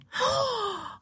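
high frightened gasp; vyděšené zalapání po dechu. Acted. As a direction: gasp in alarm. Frightened wife hears of war. (vyděšený vysoký vzdech)

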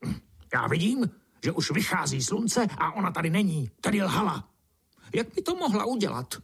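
high, indignant grumbling; squawky, offended protest; sputtering beetle. A narrator's reading. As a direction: complain huffily in a high beetle voice. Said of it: "černý brouk prskl".

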